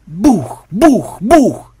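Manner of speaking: loud, high imitated bangs; three punchy vocal booms; booming thuds. A narrator's reading. Narrator voices repeated heavy blows.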